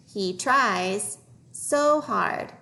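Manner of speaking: very slow, stretched words; slow reading model. Shown as a demonstration. Teacher modelling a phrase for young readers, slowly. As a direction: draw each word out for learners.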